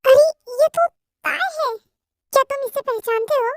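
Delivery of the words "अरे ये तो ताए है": higher, louder shocked exclamation; startled. Acted. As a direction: gasp at recognizing her. Sees accident victim. (चौंककर)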